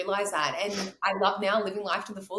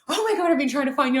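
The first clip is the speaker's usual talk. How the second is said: loud, higher, gushing on meeting; excited greeting; 7 semitones higher, 7 dB louder than usual. Retold or imitated. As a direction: imitate someone thrilled to find a friend. Retold excited meeting with coach.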